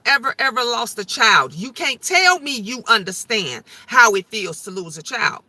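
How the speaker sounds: ordinary talk, nothing marked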